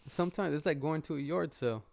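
ordinary talk

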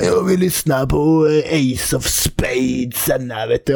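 lightly hammed-up drunk asking for help; drunk stranger. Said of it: "Så bara".

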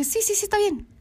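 high, quick-to-agree placating voice; retold appeasing agreement; mock compliance. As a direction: say yes to keep the peace, pitched right up. Acts agreeing to his demand.

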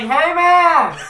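loud, high holler on the edge of laughter; retold shout. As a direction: imitate yelling across lanes. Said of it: "he shouts to me".

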